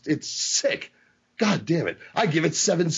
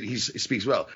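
ordinary talk, nothing marked

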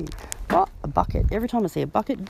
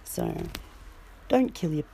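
usual speaking voice

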